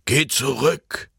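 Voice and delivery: slow, urgent order, lightly voiced old man; old man ordering Jan, slow and urgent; commanding old man. A narrator's reading. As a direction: command him to go back. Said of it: "befahl der Alte".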